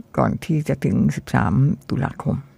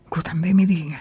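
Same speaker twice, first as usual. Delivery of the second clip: whisper; 3 semitones higher, 5 dB quieter than usual. Retold or imitated. Recalls nerves at first reading.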